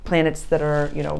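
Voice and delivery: usual speaking voice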